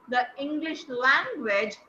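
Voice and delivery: "the English language" slow, with weight on "language"; stress on language. Shown as a demonstration. Teacher to online class, showing stress on the last content word. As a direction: lean on "language" at the end of the phrase. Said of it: "you will stress on language".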